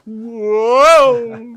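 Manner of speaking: very high, loud hammed-up whoa. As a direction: overact a startled whoa. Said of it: "and they go".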